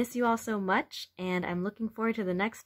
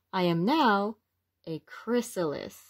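lively, expressive picture-book read-aloud; reading to children; 3 dB louder, slower than usual. A narrator's reading. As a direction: read it to little ones with wonder.

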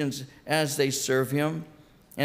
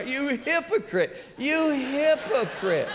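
much higher, slow rebuke; voice of the Lord; 10 semitones higher, slower than usual. Retold or imitated. The Lord rebuking the fuming pastor, high slow reproach. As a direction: let the divine rebuke ring out slowly. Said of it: "the Lord speaks to my".

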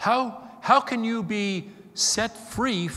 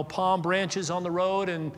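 ordinary delivery

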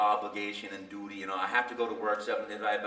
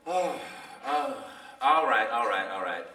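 reluctant producer; 3 dB louder than usual. Retold or imitated. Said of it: "the producer goes".

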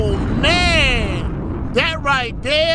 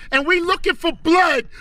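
ordinary delivery

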